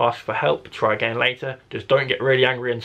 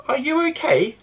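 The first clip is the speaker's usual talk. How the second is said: put-on much higher, childlike Jack asking gently; young boy voice; 13 semitones higher than usual. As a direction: pitch it up like a small boy offering help. Boy character checks on Carly.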